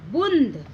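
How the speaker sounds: बूंद with a drawn-out nasal "oon"; nasal pronunciation. Shown as a demonstration. Teacher blending the letters for children, बूंद long and nasal. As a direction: hum the vowel of बूंद, then close on "d". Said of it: "बूंद को हम कैसे उच्चारण करेंगे".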